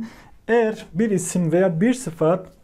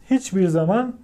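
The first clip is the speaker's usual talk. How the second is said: normal talking voice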